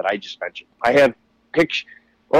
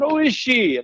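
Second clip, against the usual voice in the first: mock surprise; 12 semitones higher, slower than usual. Feigned surprise at meeting.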